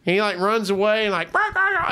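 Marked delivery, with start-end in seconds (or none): 1.3-1.9 high imitated monkey barking; quick, high animal chatter; monkey screech. Monkey running away making noise, high imitated screeching. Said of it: "like, making the noise".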